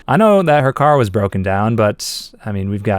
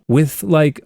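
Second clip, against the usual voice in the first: usual speaking voice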